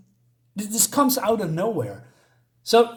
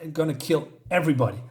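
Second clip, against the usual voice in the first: ordinary talk, nothing marked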